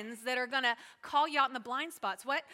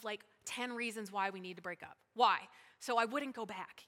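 ordinary talk

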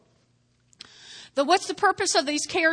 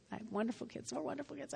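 much softer, tossed-off repeat; quiet aside; 16 dB quieter, much faster than usual. Shown as a demonstration. Pastor repeats to himself about his kids, much softer. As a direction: mutter it again under your breath.

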